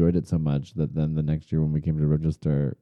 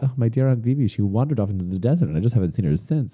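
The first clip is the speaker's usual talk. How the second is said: higher, hammed-up fake French accent, "Aunt Vivi" lifted; 6 semitones higher, faster than usual. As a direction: play the dramatic French aunt lost in the desert. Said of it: "I spoke in a French accent".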